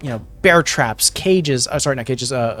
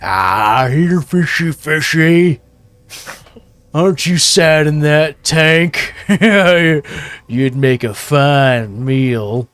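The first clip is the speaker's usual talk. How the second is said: louder, slow, taunting Tabaxi voice; giant Tabaxi enforcer; 4 dB louder, slower than usual. Acted. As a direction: play a big cat-person toying with prey, drawn out with a laugh. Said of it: "giant Tabaxi goes".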